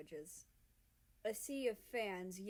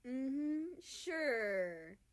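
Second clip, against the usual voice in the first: teasing; 5 semitones higher, 5 dB louder than usual. Acted. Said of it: "Canada laughs".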